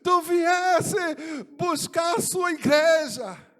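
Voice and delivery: high, loud, slow joyful shout; a man shouting with joy at his Lord's feet; joyful cry. Retold or imitated. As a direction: cry out to Jesus in overwhelming joy. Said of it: "eu dizia com alegria tão grande".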